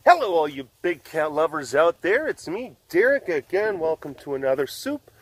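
upbeat host welcome; show host intro. Acted. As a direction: announce the episode brightly. Webcast intro announcer.

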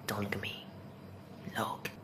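breathy hushed whisper. Real, not acted. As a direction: whisper as if hiding. Hiding from the hate comments.

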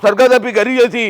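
badly imitated film-star delivery; Balakrishna mimicry. Uncle retrying the line for daughter-in-law, badly imitating Balakrishna. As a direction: push out the grand line as a poor star impression. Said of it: "Balakrishna sir mimicry సక్కగా చేస్తలేవంటే".

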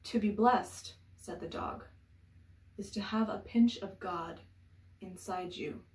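fable-like verse with emphatic openings and spacious pauses; measured poem recital; poetry reading. Acted. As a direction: lift each refrain, then settle into the line. Reads a poem aloud.

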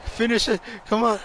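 high, loud calling to a teammate; loud, high-pitched gamer excitement; excited urging. Real, not acted. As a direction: urge them on, then cry out laughing at the miss. Urges teammate on, who misses.

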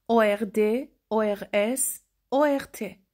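normal talking voice

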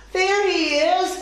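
high, excited storybook call. A narrator's reading. Tess spots the runaway gecko, high excited call.

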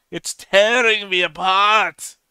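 high, loud, drawn-out melodramatic cry; horrible movie-style impression. Viewer torn over confusing plot facts, hammed-up anguished impression. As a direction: wail it out as a badly done impression, hammed-up. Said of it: "That was a horrible impression".

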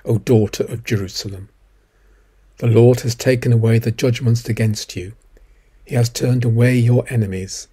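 normal talking voice

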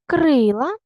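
Крила with a loud, high, stretched КРИ; stress on КРИ. Shown as a demonstration. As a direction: hold the first vowel of Крила long. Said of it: "Поставте наголос у кожному слові". (Крила з протяжним наголосом на КРИ)

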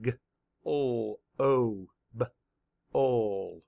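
"G, O, O, B, ol" as separate drawn-out sounds; sounded out. Shown as a demonstration. Teacher mapping the sounds of global for students, sounded out. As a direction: segment each sound, pausing between them. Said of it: "Map the sounds".

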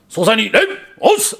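high, loud dojo greeting; big group shout. Retold or imitated. Students greeting their master outside, big high shout. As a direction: belt it out like a line of karate students. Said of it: "everyone does a big os".

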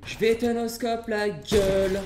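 slow, loud, high put-on menace; hammed-up slow threat; mock threat. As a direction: growl a play threat.

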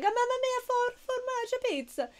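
high, loud hammy Italian chef; jolly italian pizza chef. Retold or imitated. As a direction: belt it like a cartoon pizza chef. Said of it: "I'm like".

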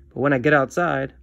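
usual speaking voice

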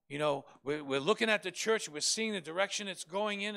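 ordinary talk, nothing marked

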